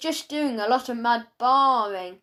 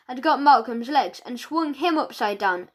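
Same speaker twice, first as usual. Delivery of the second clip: ordinary delivery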